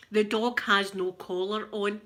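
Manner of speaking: ordinary delivery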